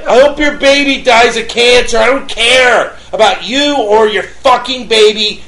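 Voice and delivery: re-enacted furious rant; angry tirade. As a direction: tear into the neighbor without letting up. Acted quarrel with the neighbor.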